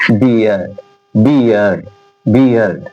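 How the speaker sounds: बियर्ड said low, three times; pronunciation demo. Shown as a demonstration. Teacher drills बियर्ड for the class, low. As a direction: model बियर्ड repeatedly. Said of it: "example देखिए".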